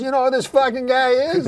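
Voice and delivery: high, eager doorman showing off a find; excited doorman. Retold or imitated. Doorman introducing Norm to people.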